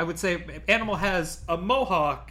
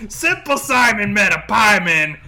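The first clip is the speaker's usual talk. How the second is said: loud mock folksy voice; 4 semitones higher, 11 dB louder than usual. Mock folksy saying, laughing.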